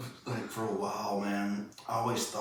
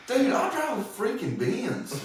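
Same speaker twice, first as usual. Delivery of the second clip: much higher, laughing through it; mock offended rich guy; 14 semitones higher, 4 dB louder than usual. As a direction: play a snooty rich faker taking offense. Rich fake panhandler getting pissy.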